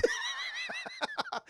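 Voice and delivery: high, wheezy laugh; helpless laughter. Real, not acted. Streamer cracking up at his own impression, high laughter. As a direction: crack up completely.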